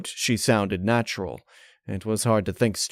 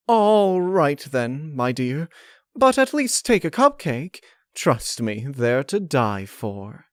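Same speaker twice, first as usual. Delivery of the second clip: higher, sweet scheming lady; Rarity voice; 6 semitones higher, slower than usual. Acted. Rarity urging Twilight to take a cupcake, syrupy sweet.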